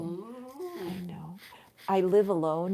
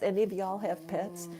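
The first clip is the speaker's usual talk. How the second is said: normal talking voice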